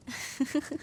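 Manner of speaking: quiet little laugh; soft happy chuckle. Acted. Servant's bond with master complete, soft happy chuckle. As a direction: chuckle softly with contentment.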